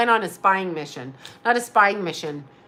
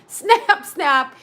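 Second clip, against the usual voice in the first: high, loud snap sounds like a shutter; playful imitated shutter clicks; camera snap noises; 6 semitones higher, 5 dB louder than usual. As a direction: mimic the camera clicking fast.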